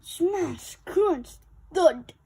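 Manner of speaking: loud smashing noises; crash sounds. A narrator's reading. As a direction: slam each word like falling metal. Playground crashes down.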